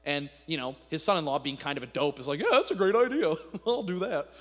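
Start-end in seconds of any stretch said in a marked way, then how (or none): 2.4-4.3 higher, louder dopey yes; dopey agreement. Retold or imitated. The dopey son-in-law eagerly agrees to Ahab's plan. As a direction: play the gullible fool.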